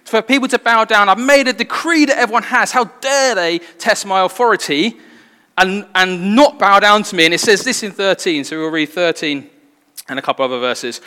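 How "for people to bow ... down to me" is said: a king roaring at defiance; angry king. Retold or imitated. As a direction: rage at them like a wounded tyrant. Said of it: "He's so angry".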